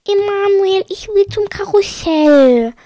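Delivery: high, loud, dragged-out whine; whining little sister. A narrator's reading. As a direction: whine like a small child demanding a ride. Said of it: "meckerte Josephine".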